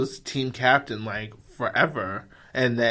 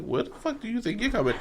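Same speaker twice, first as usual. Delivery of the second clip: put-on tough kid challenge; 10 semitones higher, 6 dB quieter, faster than usual. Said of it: "And I was like".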